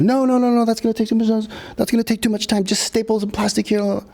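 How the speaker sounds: high, squeaky mock voice of a fussy boss. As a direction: mimic the boss cutting him off. Crew boss overruling him.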